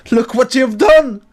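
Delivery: screamed high and loud, distraught; screaming father. Acted. Father screams at Farah over the body, high furious scream. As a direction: scream at her in grief and blame. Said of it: "he screams".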